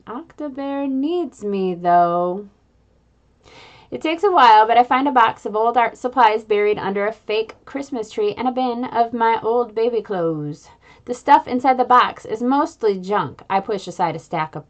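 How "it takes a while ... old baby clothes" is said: usual speaking voice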